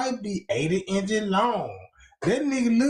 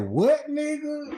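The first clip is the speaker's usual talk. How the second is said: mock baffled retort; 8 semitones higher, slower than usual. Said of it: "Be like".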